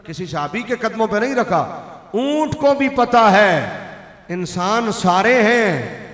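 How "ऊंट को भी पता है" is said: usual speaking voice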